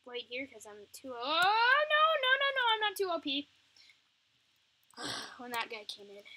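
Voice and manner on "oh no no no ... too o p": loud, high rushed no-no-no; loud, high-pitched panic as he goes down; panicked denial. Real, not acted. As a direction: protest in alarm. Panicking about dying.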